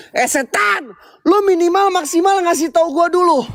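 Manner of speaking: challenging shout into the dark; yelling at the ghost. Real, not acted. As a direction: call the spirit out, high and sharp.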